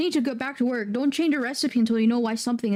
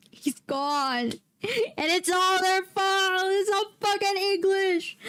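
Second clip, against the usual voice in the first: slow, high, hammed-up mock sob; mock crying; 8 semitones higher, slower than usual. Streamer to chat, hammed-up mock crying over the loss. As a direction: fake grief over a lost hero, overplayed. Mourning put-down baby Colin.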